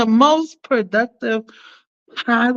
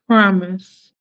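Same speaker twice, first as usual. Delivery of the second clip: ordinary talk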